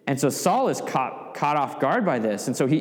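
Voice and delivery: normal talking voice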